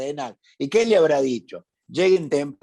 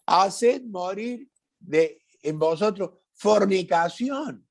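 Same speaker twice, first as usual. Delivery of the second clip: fervent preaching; 3 semitones higher than usual. Real, not acted. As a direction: preach it like a warning to the church. Preacher climaxes on Colossians list. (predicación ferviente, aguda y lenta, palabra por palabra)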